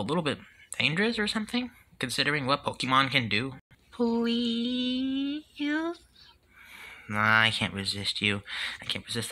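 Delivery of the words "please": high, soft, drawn-out beg; pleading. Acted. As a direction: wheedle a parent with a rising plea. Begging parent to keep pet.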